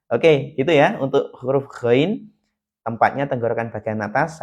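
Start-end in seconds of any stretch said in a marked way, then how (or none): none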